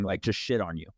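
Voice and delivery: ordinary talk, nothing marked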